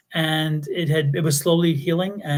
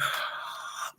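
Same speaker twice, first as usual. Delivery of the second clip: sharp acted intake of breath; shocked gasp; 14 dB quieter than usual. Child hearing mom swear, shocked gasp. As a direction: gasp as if scandalised.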